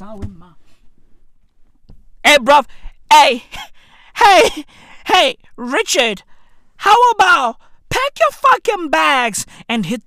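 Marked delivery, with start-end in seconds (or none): none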